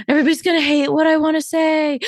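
high-pitched, rushed panic between sharp gasps; mock freaking out. Coach acting out her nerves before every podcast, panicked. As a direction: gasp and blurt it in hammed-up panic. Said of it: "when I start freaking out going".

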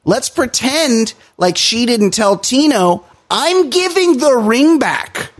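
high, slow, sarcastic mock of the show's producers; sneering imitation of producers pretending nothing happened. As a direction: drag it out in a high, taunting voice. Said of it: "They're like".

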